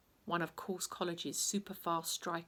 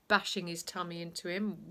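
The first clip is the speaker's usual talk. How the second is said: plain reading voice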